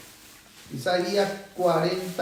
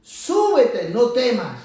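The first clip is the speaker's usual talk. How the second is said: raised, emphatic command; full-lunged preaching; 3 semitones higher, slower than usual. Shown as a demonstration. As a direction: proclaim it boldly with lifted pitch. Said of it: "a todo pulmón predicar".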